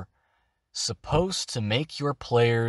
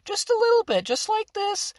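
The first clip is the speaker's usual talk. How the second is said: much higher, thin demo voice; higher pitch; faster than usual. Shown as a demonstration. Teacher showing players the hag's base, high-pitched voice. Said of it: "I'm gonna go a little bit higher".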